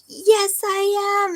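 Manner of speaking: high, loud shout of defiance; defiant yell back. Retold or imitated. Pushing toward the finish line, yelling back defiantly. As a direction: fire it back at the voices. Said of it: "you'd be like".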